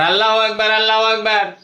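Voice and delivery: আল্লাহু আকবার clipped quick, no stretch; rushed takbir. Shown as a demonstration. Teacher mocking a careless hurried takbir. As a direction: toss off আল্লাহু আকবার hastily. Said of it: "এটা কি একটা কথা হলো?".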